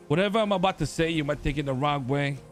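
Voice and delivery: ordinary delivery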